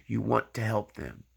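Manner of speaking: normal talking voice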